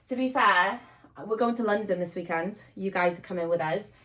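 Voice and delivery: ordinary talk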